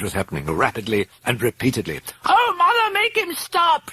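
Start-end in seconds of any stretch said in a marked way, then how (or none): 2.3-3.9 very high hysterical screech. A narrator's reading. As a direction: screech for help in terror. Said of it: "she was screeching".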